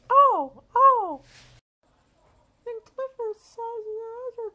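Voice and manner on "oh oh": startled oh oh. Acted. Water turned on full blast.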